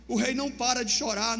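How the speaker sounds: ordinary delivery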